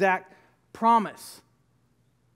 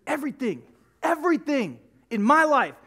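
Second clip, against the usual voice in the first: ordinary talk, nothing marked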